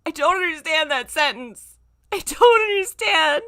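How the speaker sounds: high, loud laughing protest; crying with laughter. Real, not acted. Reader baffled by the fic's sentence, crying laughing. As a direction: wail it through tears of laughter.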